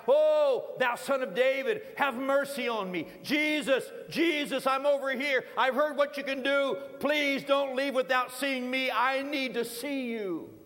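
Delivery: loud, high plea to Jesus; crying out. Acted. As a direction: call out urgently to be noticed from afar. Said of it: "begins to cry out".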